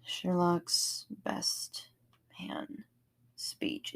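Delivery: breathy reverent whisper; barely voiced whisper, slowed right down. Real, not acted. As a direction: hush it like a sacred title. Reverent aside about favorite scene.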